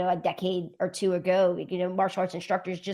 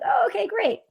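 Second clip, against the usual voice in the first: high-pitched pleased surprise; light, delighted relief; relieved surprise; 13 semitones higher than usual. Retold or imitated. Instructor hearing everyone had fun, high relieved surprise.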